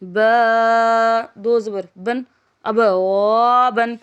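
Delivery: slow chant, letters drawn out then joined; Qaida spelling drill. Shown as a demonstration. Teacher chanting letters for pupils, drawn-out sing-song spelling. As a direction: spell it out for a child learning Quran letters.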